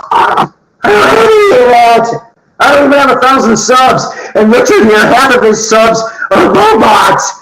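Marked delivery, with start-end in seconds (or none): 0.1-4.0 much higher, mock crying whine. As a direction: whine it like a hammy sob story. Mock complaint about no money.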